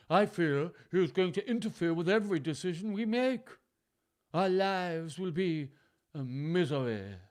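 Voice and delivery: high, loud, stagey daughter's voice; Goneril's complaint. A narrator's reading. Goneril frets to her sister about father interfering, high loud complaint. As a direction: voice a fretting sister, slowing towards the end. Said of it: "Goneril pointed out".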